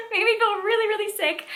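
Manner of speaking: high, squeaky-pitched laughing repeat; laughing disbelief. Real, not acted. Reader admitting the book sickened her, laughing in disbelief. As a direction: say it again through a laugh, pitch shooting up.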